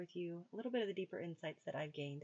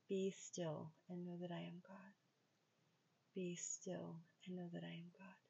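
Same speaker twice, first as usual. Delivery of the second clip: softer and fading, like repeating it in your mind; quiet repeating; 6 dB quieter than usual. Shown as a demonstration. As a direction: murmur the phrase to yourself, trailing off. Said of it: "just repeat in your mind".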